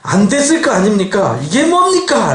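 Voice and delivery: high-pitched complaint to God; acted-out aggrieved questioning; indignant protest. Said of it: "라고 이제 항의를 하는 거예요".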